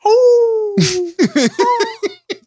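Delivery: high, loud cackling laugh; real loud laughter. Real, not acted. Cohost cracking up at the actual whale joke. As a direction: break up completely at the joke.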